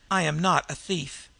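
ordinary delivery

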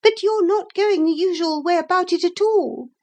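very high, thin, critical nagging; thin, disagreeable voice. A narrator's reading. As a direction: find fault in a high, disagreeable voice. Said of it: "a thin and disagreeable voice".

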